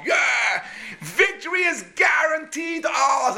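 crowd cheer. Retold or imitated. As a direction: roar it back with confidence. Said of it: "And everyone's".

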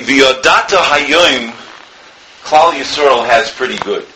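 ordinary talk

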